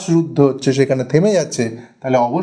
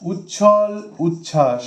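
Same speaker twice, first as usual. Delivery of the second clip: উচ্ছল উচ্ছ্বাস drawn out syllable by syllable; slow and careful; much slower than usual. Shown as a demonstration. Teacher repeating the exam word slowly for students. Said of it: "এই শব্দটা কিন্তু টেটে".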